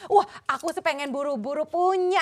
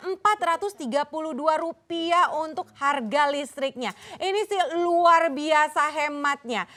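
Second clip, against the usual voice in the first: infomercial; 2 semitones lower than usual. Acted. Host lists offer and bonuses.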